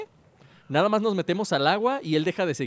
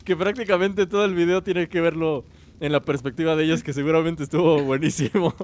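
youtuber outro; 3 semitones higher, 3 dB louder than usual. Acted. Channel outro.

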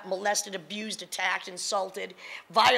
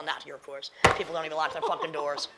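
usual speaking voice

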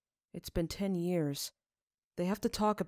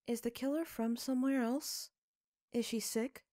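put-on child Sophie; 7 semitones higher than usual. A narrator's reading. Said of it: "Sophie asks".

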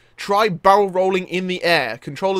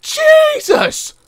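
very high excited squeal easing down; thrilled, high-pitched outburst; amazed; 14 semitones higher, 5 dB louder, slower than usual. Real, not acted. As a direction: burst out in astonished admiration. Amazed by stunt.

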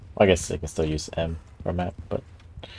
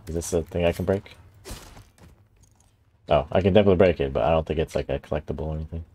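ordinary delivery

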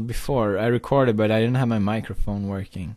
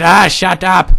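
raised, louder retort; irritated father; 6 semitones higher, 8 dB louder than usual. Acted. Father silencing screaming son, irritated and loud. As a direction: cut the kid off crossly.

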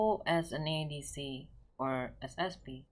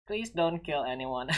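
ordinary talk

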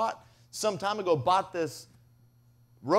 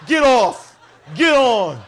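loud shouted commands; booming mock pony handler; barked orders; 3 semitones higher, 13 dB louder, slower than usual. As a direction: bark it at the kids like a pony handler. Pony man barking orders at kids.

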